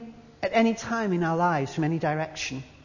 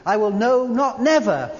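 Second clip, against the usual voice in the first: no, not, never each punched louder; triple negative stressed; 3 semitones higher, 5 dB louder than usual. Shown as a demonstration. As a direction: build the three negatives. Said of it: "a triple negative".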